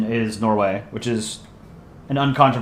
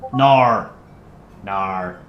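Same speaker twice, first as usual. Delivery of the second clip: put-on Aussie accent; 5 semitones lower than usual. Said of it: "my Aussie accent is trash".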